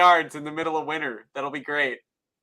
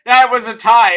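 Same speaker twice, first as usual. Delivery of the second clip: mock indignant protest; 6 semitones higher, 11 dB louder than usual. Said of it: "they're like".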